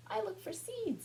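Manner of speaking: high-pitched little bird voice; sparrow character. A narrator's reading. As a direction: speak as a tiny sparrow. Says the sparrow.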